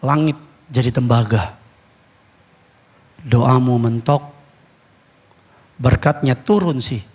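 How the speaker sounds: very low, measured preaching with long pauses; solemn pulpit warning. Real, not acted. Pastor warning unfaithful spouses from the pulpit, solemn. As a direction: pronounce it gravely, phrase by phrase.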